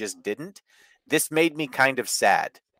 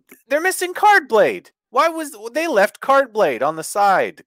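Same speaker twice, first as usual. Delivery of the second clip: mock outrage; 11 semitones higher, 6 dB louder than usual. Retelling stable's outraged realisation.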